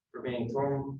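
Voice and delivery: ordinary talk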